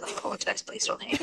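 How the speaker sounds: sheepish whisper. Real, not acted. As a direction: whisper a guilty apology. Apologizing for bad accent.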